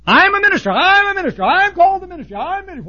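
high, loud self-important boasting; mocking braggart. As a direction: brag it like a pompous show-off. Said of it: "advertise it like a jackass".

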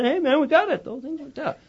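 the mind calling out with glee; lightly voiced buoyant inner voice; excited unconscious.

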